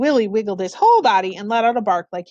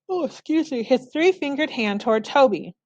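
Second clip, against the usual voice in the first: ordinary delivery, nothing marked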